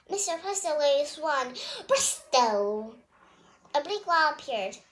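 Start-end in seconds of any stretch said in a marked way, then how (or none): 1.9-2.9 lower, booming magic word. A narrator's reading. Magician casting a spell, booming magic word. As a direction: proclaim the spell like a stage magician.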